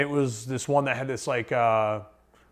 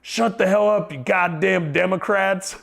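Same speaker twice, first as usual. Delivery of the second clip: higher, slower retold yell, laughing at the end; imitated yell breaking into a laugh; mock yell from a stranger; 7 semitones higher, 4 dB louder than usual. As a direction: bark it like the angry older guy. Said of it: "the guy yells again".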